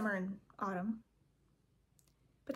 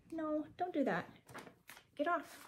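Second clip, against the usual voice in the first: higher, firm telling-off of a pet; scolding the cat; 6 semitones higher, 4 dB quieter than usual. Real, not acted. Vlogger telling her cat to get off the paper, firm scold.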